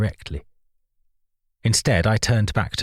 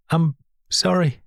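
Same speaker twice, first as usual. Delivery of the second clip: higher, crying apology; tearful apology; 8 semitones higher than usual. A narrator's reading. Rachel apologising to the angry narrator, crying. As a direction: let the tears break through. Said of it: "properly started to cry now".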